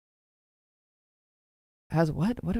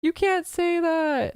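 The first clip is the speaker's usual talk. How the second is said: mock scolding; 10 semitones higher, slower than usual. Acting out group's shocked reaction.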